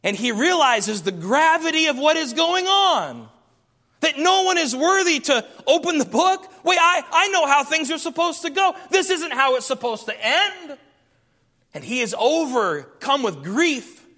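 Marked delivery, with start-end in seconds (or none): 4.0-10.8 dismayed John. Acted. Voicing John's dismay.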